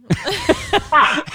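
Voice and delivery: high loud burst of laughter. Real, not acted. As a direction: break into helpless laughter. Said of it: "lost it".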